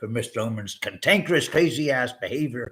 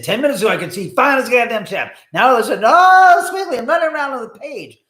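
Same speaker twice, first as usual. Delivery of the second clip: jittery excited yell; 10 semitones higher, 11 dB louder, faster than usual. Pretend. Caffeine jitter rant.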